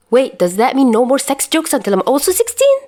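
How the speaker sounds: loud, high teasing whine; mock dismay. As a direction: play it up as fake panic. Teasing exaggerated question.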